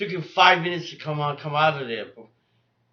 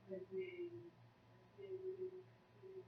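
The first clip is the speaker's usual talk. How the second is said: tender cooing to cat; 27 dB quieter than usual. Real, not acted. Cooing softly to his cat.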